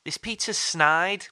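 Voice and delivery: put-on a light Welsh accent, drawn out. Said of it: "don't say I just did a Welsh accent".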